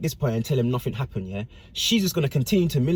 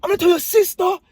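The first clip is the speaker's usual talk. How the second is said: much higher, louder furious yelling of threats; angry threats; 6 dB louder than usual. Retold or imitated. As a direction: yell threats at him from the road. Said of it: "He's like".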